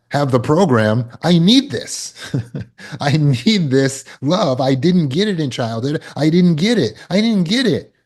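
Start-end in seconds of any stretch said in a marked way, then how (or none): 1.2-7.9 put-on needy inner child. Speaker voicing the listener's inner need, pleading and rising. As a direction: voice of the needy inner child, pitched higher and building.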